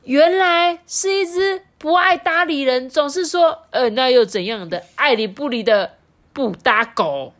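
realizing it at last; sudden realization. Acted. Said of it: "恍然大悟的说". (恍然大悟地高声说)

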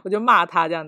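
ordinary delivery

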